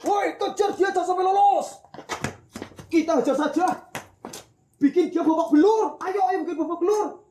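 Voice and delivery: high, loud mob yelling to chase the thief; angry mob shout. Acted. Villager yelling at neighbors to catch the thief, loud angry shout. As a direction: shout at the crowd to beat him up.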